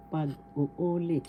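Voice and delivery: slow pag-uulit with a long second "u"; stress on third syllable. Shown as a demonstration. Teacher repeating the word for retell or reiterate, stress on third syllable. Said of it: "The third syllable has the accent".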